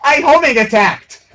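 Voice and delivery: high, loud yell at the game; frustrated shout. Real, not acted. Gamer yelling at the screen after a failed homing attack, frustrated shout.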